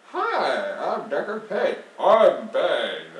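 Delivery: hammed-up old game character voice, slow and drawn out; Deckard Cain. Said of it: "bust out the Deckard Cain voice".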